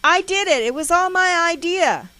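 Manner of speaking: high, loud desperate confession; shrieked admission under attack; hysterical scream. A narrator's reading. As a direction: scream it out as a terrified child. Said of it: "I scream hysterically".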